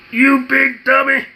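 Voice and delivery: put-on high, loud, slow comic old man scolding; Red Fox voice. Doing Red Fox for his viewers, a loud, slow comic scold. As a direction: drag it out like a cranky junk dealer. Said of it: "Let me say it in my Red Fox voice".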